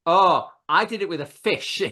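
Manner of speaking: ordinary talk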